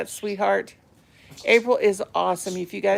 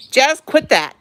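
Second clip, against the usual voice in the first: louder, higher "quit that" to the bird; sharp scold; 7 semitones higher, 8 dB louder than usual. Real, not acted. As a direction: stop the bird with a quick firm call. Owner scolds bird picking again.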